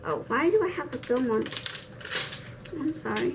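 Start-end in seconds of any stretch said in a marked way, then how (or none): none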